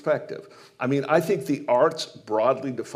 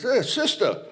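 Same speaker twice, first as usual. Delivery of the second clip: a hint of a hearty jock, pitched higher; breezy big-guy hello, lightly voiced; coach greeting a nun; 9 semitones higher than usual. Coach greeting the nun at the banquet, lightly voiced jock.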